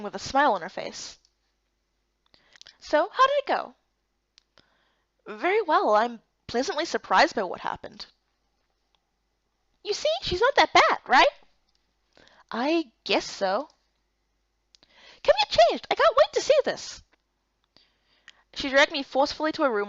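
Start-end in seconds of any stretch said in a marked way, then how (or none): 2.8-3.7 put-on bubbly young woman, much higher; bright, teasing friend voice, pitched up high; cheerful high girl. Ikar asks eagerly. 9.8-11.3 put-on bubbly young woman, much higher; bright, teasing friend voice, pitched up high; cheerful high girl. Ikar teasing Erica. 15.2-17.0 put-on bubbly young woman, much higher; bright, teasing friend voice, pitched up high; cheerful high girl. Ikar excited to dress her.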